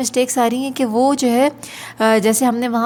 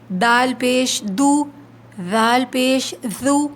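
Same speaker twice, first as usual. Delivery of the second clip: slow, deliberate recitation of each letter with pesh; slow letter drill; much slower than usual. Shown as a demonstration. Teacher leading students through the drill, slow recitation. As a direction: chant each pair slowly for repeating.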